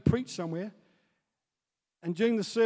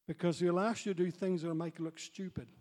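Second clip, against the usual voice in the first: ordinary talk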